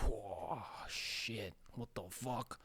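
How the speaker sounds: very soft, awestruck murmur; softly voiced pretend disbelief; hushed mock shock. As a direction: act stunned under your breath. Acts out stunned reaction to eyes.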